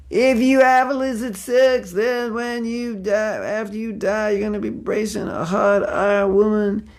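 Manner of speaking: high, sustained hellfire sermon parody; fire-and-brimstone preacher. Acted. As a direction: preach damnation like a hammy Bible thumper. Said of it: "that was not the recommended preaching technique".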